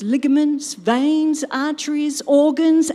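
ordinary talk

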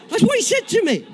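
high, loud, laughing exclamation; amused disbelief. Real, not acted. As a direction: exclaim it to the crowd, pitch soaring. Amazed aside to audience, laughing.

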